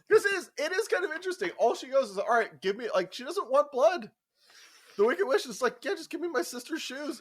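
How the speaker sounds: excited. Real, not acted. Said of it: "excited rant about the witch".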